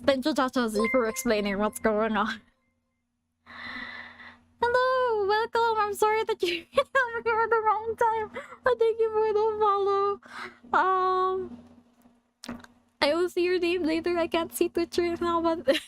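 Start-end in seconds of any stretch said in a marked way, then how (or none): 4.6-15.8 high-pitched cartoon alien greeting; playful high character voice with an accent; put-on Stitch voice. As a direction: welcome newcomers in a high silly Stitch voice. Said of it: "while I'm doing this voice and this accent".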